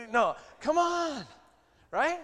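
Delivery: high, loud, slow call to action; rally them, come on; loud coaxing shout; urging shout. Real, not acted. Pastor urging the congregation.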